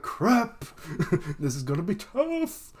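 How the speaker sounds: high, far voice in alarm; panicked cry. Retold or imitated. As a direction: blurt it out in a panic. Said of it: "so Brooks like".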